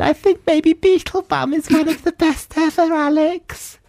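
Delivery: very high squeaky fawning voice; hammed-up starstruck falsetto; gushing fan. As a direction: gush at a star in a silly falsetto. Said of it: "Guess what I said?".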